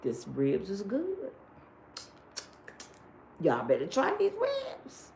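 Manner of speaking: soft, slow coaxing. Retold or imitated. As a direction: coax them quietly into eating. Pushing ribs on them.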